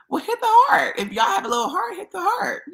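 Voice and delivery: ordinary talk, nothing marked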